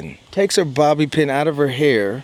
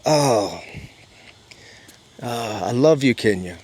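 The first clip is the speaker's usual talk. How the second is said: tender; 3 semitones lower than usual. Real, not acted. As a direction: speak to Kenya with love. Emotional thanks to Kenya.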